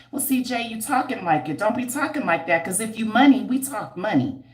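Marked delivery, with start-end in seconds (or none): none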